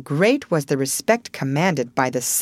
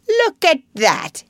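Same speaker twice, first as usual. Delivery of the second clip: slow proud exclamation; excited cry; 10 semitones higher, 5 dB louder than usual. A narrator's reading. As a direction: show off the money gleefully. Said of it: "he cried".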